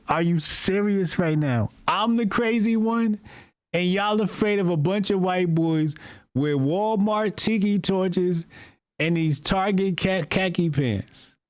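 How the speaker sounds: high, disbelieving retold rant; mock outrage at being called crazy; incredulous disbelief. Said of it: "'Cause I'm like".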